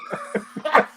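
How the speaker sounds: high-pitched burst of laughing; real laughter. Real, not acted. Host reacting to friend's fart remark, real laughter. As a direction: crack up helplessly.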